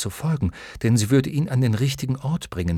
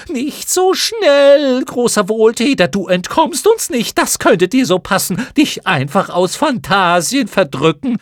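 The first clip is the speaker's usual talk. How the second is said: screaming chorus; 11 semitones higher, 9 dB louder than usual. Acted. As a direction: jeer it back together. Schlamuffen halten ihn fest. (Mottenchor schreit höhnisch und sehr hoch)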